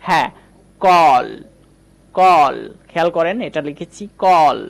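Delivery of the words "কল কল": "কল" twice, loud, with a drawn-out "aw"; teacher modelling "কল" firmly as "kawl"; long vowel demonstration. Shown as a demonstration. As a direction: stretch the "অ" in "কল", pausing between repeats. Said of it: "আমি যদি বলি".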